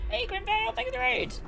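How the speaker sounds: high, bright squeaky thank-you; cute anime streamer. Acted. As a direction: squeal it out like an excited idol. Greeting a raider.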